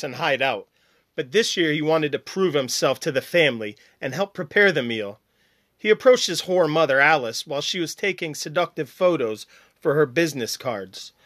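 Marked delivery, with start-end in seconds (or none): none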